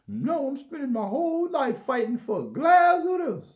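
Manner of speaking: high, loud crying mock wail; mock activist wail. Mocking activists, a high loud crying wail. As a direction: wail it like a fake sob.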